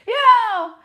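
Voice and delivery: high, loud put-on voice for the returning ex; mock-cheery shout from the ex; mock ex calling. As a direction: squeal it like the ex showing up. Playing the ex calling back.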